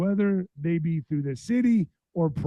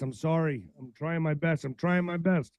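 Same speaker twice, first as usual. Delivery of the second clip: ordinary talk, nothing marked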